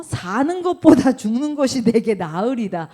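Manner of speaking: loud, anguished plea to die; Jonah's anguish. Acted. Preacher voicing Jonah, loud despair. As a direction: cry it out like the despairing prophet.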